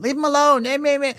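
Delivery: high, whiny mocking of the wife. Mother shielding son, mocked with high whine. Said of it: "the woman".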